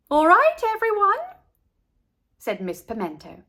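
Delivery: high, loud bright teacher; cheerful teacher. A narrator's reading. Miss Pimento addresses class.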